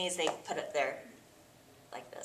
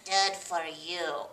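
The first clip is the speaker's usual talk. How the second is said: slow, unimpressed; deadpan, slow; dry doctor cat; 3 semitones lower, much slower than usual. Acted. Doctor Cat answers Gerald, slow and deadpan.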